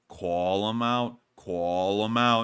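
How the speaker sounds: slow, stretched-out words; drawn-out call. Pretend. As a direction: draw each word out slowly. Drawn-out repeated catchphrase call.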